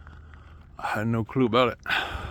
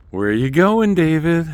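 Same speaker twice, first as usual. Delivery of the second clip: loud eerie voice; weird voice; 6 semitones higher, 11 dB louder than usual. Retold or imitated. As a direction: call out like a spooky shoulder demon. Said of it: "the weird voice".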